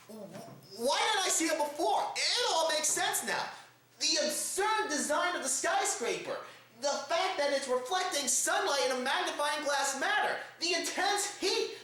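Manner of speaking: ordinary delivery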